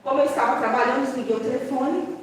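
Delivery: normal talking voice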